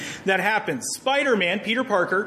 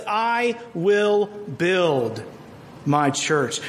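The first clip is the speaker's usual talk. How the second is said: each word drawn out and weighted; slow, deliberate quotation of a promise; slow solemn quote; much slower than usual. Shown as a demonstration. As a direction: lay down every word of the promise slowly. Quoting Jesus' promise, slowed.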